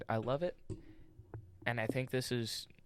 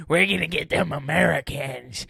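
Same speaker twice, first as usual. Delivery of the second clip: pirate voice; 4 semitones higher, 11 dB louder than usual. Retold or imitated. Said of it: "they're like".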